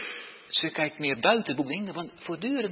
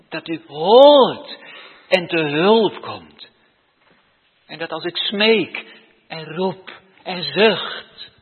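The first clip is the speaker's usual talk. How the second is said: slow, much louder cry to God; loud pleading prayer; 11 dB louder, slower than usual. Acted. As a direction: cry out that God must hear. Prayer swells into loud plea. (luide, smekende uitroep)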